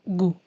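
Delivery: "gu" said as a short hard g plus u; the gu sound. Shown as a demonstration. Teacher sounding out "gu" for young readers. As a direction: sound out "gu" slowly and clearly. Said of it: "el sonido será".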